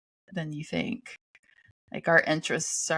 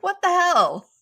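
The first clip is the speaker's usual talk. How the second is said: high, loud disbelieving cry; shocked outburst; 13 semitones higher, 7 dB louder than usual. Retold or imitated. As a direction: blurt it out in shock. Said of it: "I'm like".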